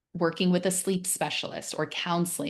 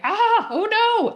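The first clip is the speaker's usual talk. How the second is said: much higher, louder, slow alarmed cry; mock panic; 7 dB louder, slower than usual. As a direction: panic on waking at night. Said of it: "we're like".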